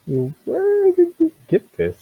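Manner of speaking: high, slow, drawn-out character voice; curious shopkeeper. Acted. Shopkeeper asks about the strange book, curious. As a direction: stretch the question out in a high voice.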